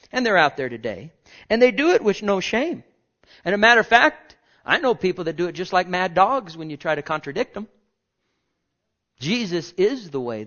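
loud, high fiery preaching; preaching at full force. Acted. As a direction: thunder it from the pulpit.

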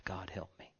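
soft whispered plea; a hushed prayer to God. Real, not acted. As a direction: murmur it as a private prayer.

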